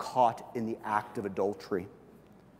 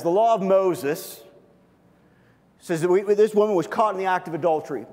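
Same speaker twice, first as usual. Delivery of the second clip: accusing religious leaders; 7 semitones higher, 8 dB louder than usual. Retold or imitated. Leaders demanding Jesus judge the woman, loud and accusing. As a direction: bark the charge at Jesus like a stern elder.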